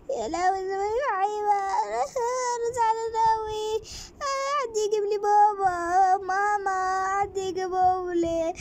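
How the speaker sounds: high, sobbing plea for mum and dad; crying child. Acted. Kidnapped girl begging for her parents, sobbing. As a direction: wail like a lost little girl.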